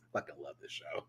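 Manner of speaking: soft breathless whisper to yourself. Real, not acted. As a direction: confide it under your breath. Whispered aside to self.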